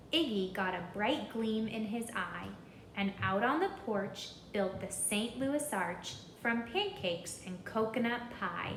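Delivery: ordinary delivery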